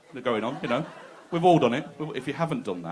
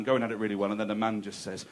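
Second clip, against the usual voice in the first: ordinary delivery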